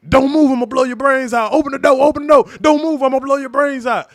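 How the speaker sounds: barked threat, loud and high; a loud, tense recreation of the officer's orders; retold shouted command. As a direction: yell it like a cop with a gun drawn. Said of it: "he telling me".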